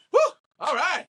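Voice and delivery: high exhaled whew, then recovering; acted relief. Actor after the director says cut, acted relief. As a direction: let the tension out, imitating relief. Actor shaking off a heavy take.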